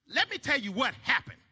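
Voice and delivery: ordinary talk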